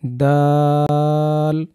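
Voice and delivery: দাল held very long; drawn-out letter. Shown as a demonstration. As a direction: stretch দাল slowly. Teacher models letter. (দাল অনেক টেনে)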